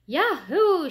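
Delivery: high, loud cheer; excited shout. A narrator's reading. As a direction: whoop it out like a delighted schoolkid. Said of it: "shouted Maurecia".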